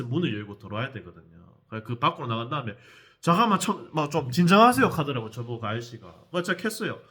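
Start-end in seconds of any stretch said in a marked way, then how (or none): 3.2-4.9 retold loud plea. Man urges narrator to calm down.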